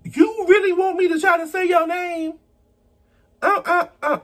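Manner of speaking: squeaky high disbelieving voice; mock high squeal. Real, not acted. Reacting to a viewer's hard name, squeaky high disbelief. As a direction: pitch way up, pretending to panic.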